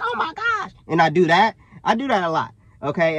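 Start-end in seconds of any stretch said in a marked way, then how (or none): 0.0-0.7 very high, squealed joke voice; strained falsetto exclamation; voice going up. Shown as a demonstration. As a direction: shoot up to a comic high note. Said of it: "not to make my voice go up".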